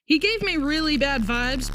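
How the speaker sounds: ordinary talk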